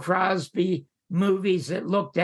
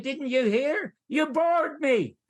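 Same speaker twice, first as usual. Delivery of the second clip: higher-pitched mock yell, no real volume; retold yell back; 8 semitones higher, slower than usual. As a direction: fire it back as an imitated yell, drawing out the last words. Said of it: "And I yelled back".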